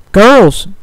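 loud, high alarmed call; shout. Acted. Applebloom shouting to warn her arguing friends, alarmed. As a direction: shout to snap them out of it. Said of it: "Applebloom shouted".

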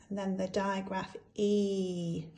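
usual speaking voice

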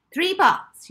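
high, loud, emphatic Three baths; shouted repeat. Acted. Matron hammering the number home to Molly. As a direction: bark Three baths right at her.

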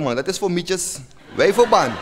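ordinary talk, nothing marked

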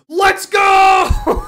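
screaming let's go, very high; victory shout. Real, not acted. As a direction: explode with joy at the win. Big win shout.

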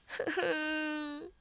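high acted crying sob. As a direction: sob like a weeping old tree. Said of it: "lloró desconsoladamente".

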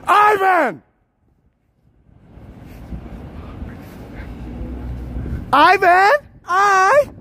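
very high full scream; piercing high holler; screaming a name. Shown as a demonstration. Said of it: "I'm gonna scream Ivan".

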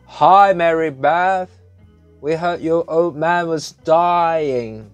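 put-on slow, loud, high boy's voice; brash kid booming at the door. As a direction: blurt it out tactlessly. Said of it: "Matt spoke out loudly".

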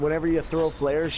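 ordinary talk

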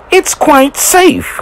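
high, drawn-out, insistent squeal; cross hissing. A narrator's reading. Thomas to his crew as brakes bite, hissing. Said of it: "he hissed".

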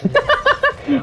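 very high, light laughing squeal; high giggle. Real, not acted. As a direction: giggle at your avatar. Laughs at bum cheeks.

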